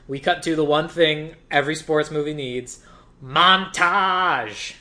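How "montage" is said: louder, higher, drawn-out reveal; mock-grand announcement. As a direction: announce it like a big title, stretching it out. Dramatic reveal of sports-movie staple.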